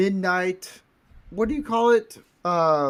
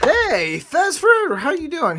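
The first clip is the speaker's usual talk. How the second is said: mock greeting; 11 semitones higher than usual. Mimics a buddy's hearty greeting.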